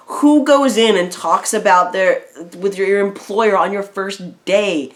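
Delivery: mock-indignant rhetorical question, stretching it out; incredulous outburst. Retold own incredulous reaction to story.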